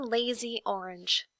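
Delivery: plain reading voice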